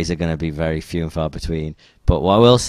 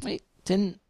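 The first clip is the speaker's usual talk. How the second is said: confused; 13 semitones higher than usual. Retold or imitated. Retold confusion over the news.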